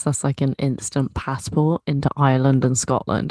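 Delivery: ordinary talk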